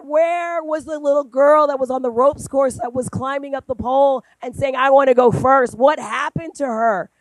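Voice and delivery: loud, high challenge that slows at the end; loud rousing challenge. Retold or imitated. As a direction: stir her up to be bold again. Challenging niece to be bold.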